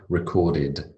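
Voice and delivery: "recorded" with an extra "id" syllable; id ending. Shown as a demonstration. Teacher models past-tense endings to class, id ending on "recorded". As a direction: finish "recorded" on a voiced id sound. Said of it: "with an id".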